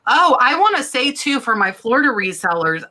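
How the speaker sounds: normal talking voice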